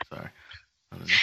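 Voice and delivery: normal talking voice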